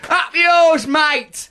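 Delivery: high, loud mock yell of "Up yours, mate"; rude shout. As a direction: bark it like a cheeky lout.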